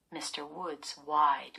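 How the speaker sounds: ordinary delivery, nothing marked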